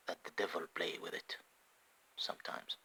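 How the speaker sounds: whispered aside about "the devil"; hushed whisper. Real, not acted.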